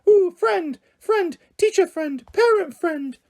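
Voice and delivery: very high, loud squeaky chant. Acted. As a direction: squeal it like the meme. Both chant the squeaky meme.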